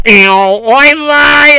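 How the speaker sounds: sudden loud oy vey cry; pained oy vey. Acted. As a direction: yelp it as you slip. Slips on the icy step.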